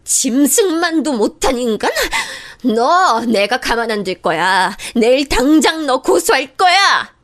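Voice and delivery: shocked sister-in-law threatening to sue; incredulous furious echo. Acted. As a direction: repeat 짐승만도 in outraged disbelief then threaten.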